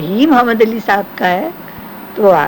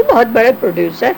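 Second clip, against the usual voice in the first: usual speaking voice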